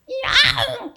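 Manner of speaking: very high, laughing 야옹; a high, giggly meow to tease the kids; laughing mock meow. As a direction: squeak out a silly cat meow. Person meowing when troubled, joke.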